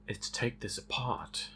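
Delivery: sultry breathy seductive tone. Real, not acted. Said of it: "Don't know why I said that in a seductive voice".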